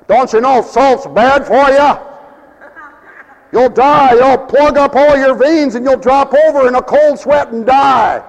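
high, loud warning shout; hammed-up scolding about salt; mock alarmed warning.

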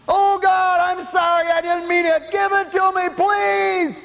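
pleading to God. Retold or imitated. Retold plea to God.

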